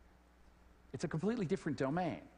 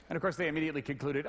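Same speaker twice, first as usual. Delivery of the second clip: ordinary delivery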